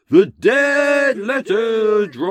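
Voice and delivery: loud, high, drawn-out title call; dramatic segment announcement. Pretend. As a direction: stretch the title out like a booming jingle.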